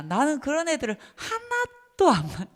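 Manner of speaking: put-on quoting a teacher in a high Jeolla accent, 하나도 falling; high Jeolla accent imitating a fellow teacher; Jeolla dialect. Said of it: "전라도 사투리로 응팔에서 제가 들었어요".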